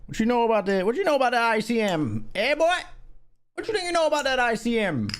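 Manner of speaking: high-pitched mock Jamaican accent; hammed-up Jamaican lilt, high. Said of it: "my accent turning Jamaican".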